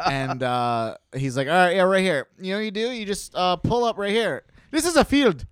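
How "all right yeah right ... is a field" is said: mock driver voice. Imitating the taxi driver.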